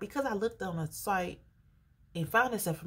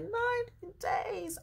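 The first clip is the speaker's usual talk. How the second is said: much higher, drawn-out outrage; can't believe the wait; shocked disbelief; slower than usual. Retold or imitated. Hearing the ninety-day wait from the agent, shocked and high. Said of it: "I was so upset".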